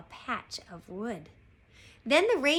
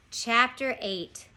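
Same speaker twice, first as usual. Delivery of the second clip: plain reading voice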